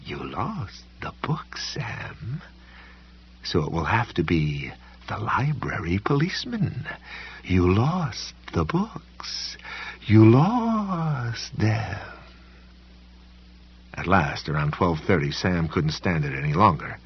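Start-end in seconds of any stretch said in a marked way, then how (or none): 0.0-12.0 eerie slow whisper; breathy menacing whisper; Ardelia's whisper. A narrator's reading. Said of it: "if she began to whisper to him".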